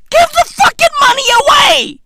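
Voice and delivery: loud high yell, every word hit; furious shout. Real, not acted. Yelling at the heiress to give the money away. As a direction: scream the demand.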